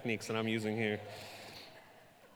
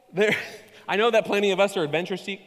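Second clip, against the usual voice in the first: ordinary delivery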